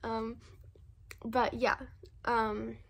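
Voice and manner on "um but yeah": ordinary delivery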